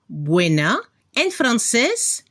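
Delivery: normal talking voice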